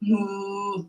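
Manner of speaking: low soft cow moo. Pretend. Kids yoga cat cow sounds.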